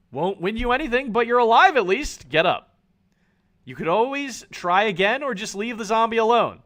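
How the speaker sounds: ordinary delivery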